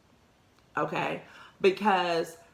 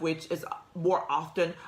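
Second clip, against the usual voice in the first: ordinary delivery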